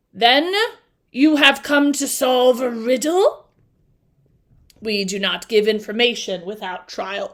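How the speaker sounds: a stern Sphinx demanding a trial. Acted. Reads the Sphinx's lines.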